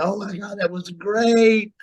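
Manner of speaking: high, drawn-out mock gush; retold backstage cheer. Locker room cheering him backstage.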